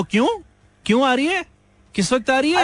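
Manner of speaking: high, pestering mock question. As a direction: imitate a nosy caller asking why. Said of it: "जिस पे तुम कहो".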